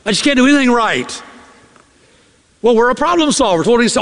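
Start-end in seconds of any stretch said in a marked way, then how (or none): 0.0-1.2 mock lament. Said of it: "She'll say to you".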